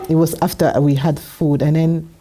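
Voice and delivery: ordinary delivery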